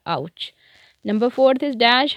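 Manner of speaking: usual speaking voice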